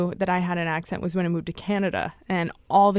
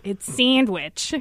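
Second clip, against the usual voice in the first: slow, drawn-out Chicago "sandwich" with a flat nasal a; "sandwich" stretched long, the first vowel flat and nasal, Chicago style; much slower than usual. Retold or imitated. Parents correcting their son in a slow Chicago accent. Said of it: "they heard it as sandwich".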